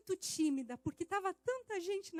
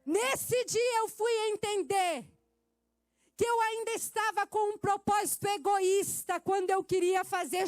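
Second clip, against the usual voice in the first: preaching at full force; 8 dB louder, slower than usual. Acted.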